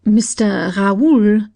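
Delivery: slow, hesitant stammer of bad news. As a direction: drag the name out, afraid to say it.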